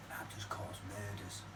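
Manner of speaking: quiet, slow whispered confession. Real, not acted. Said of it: "whispered aside to grandkids".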